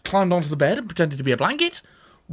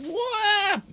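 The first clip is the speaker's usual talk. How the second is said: high yelp. Acted. Grandad thrown through the air.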